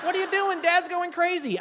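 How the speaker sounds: high-pitched mock alarm; frantic mom. Comic as mom calling her daughter about dad, frantic. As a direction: imitate a worked-up mother on the phone.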